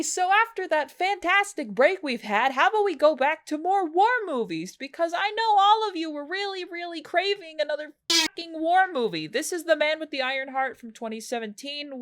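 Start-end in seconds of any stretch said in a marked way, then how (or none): 0.0-9.3 much higher, sing-song fake enthusiasm; mock-cheerful sarcasm. Real, not acted. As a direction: pretend to be thrilled about another war movie. Sarcastic intro of another war movie.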